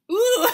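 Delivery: delighted high ooh; loud ooh. Real, not acted. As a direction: belt out a surprised ooh. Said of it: "ooh, let me see".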